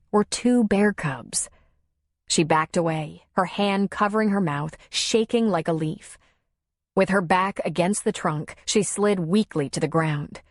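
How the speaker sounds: plain reading voice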